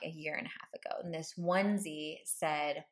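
ordinary delivery

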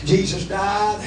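usual speaking voice

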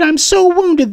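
high, whiny mock self-pity; mocking a self-pitying whiner, high and whiny; mock wounded whine. Mocking a whiny attention seeker.

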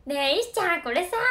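overly casual, like talking to a buddy; casual overfamiliar. Retold or imitated. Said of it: "タメ口". (石ちゃんに馴れ馴れしくタメ口で話しかける)

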